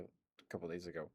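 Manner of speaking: ordinary delivery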